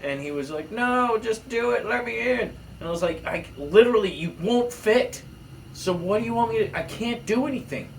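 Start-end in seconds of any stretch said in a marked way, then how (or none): 0.7-2.4 put-on whiny kid insisting. 3.2-7.9 put-on exasperated kid.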